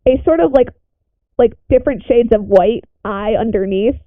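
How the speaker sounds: normal talking voice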